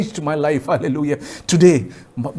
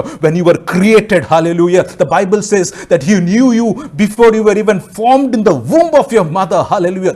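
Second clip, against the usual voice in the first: loud, raised proclamation of scripture; forceful preaching; 5 semitones higher, 6 dB louder than usual. Real, not acted. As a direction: lift the voice and proclaim it to the congregation.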